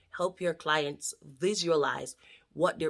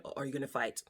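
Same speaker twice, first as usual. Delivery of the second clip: ordinary talk, nothing marked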